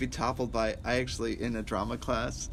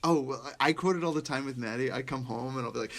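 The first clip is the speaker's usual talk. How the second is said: ordinary talk, nothing marked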